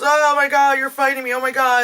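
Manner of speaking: high, loud freaked-out cries; panicked exclaiming. Retold or imitated. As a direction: yelp it out in alarm. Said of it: "he just goes".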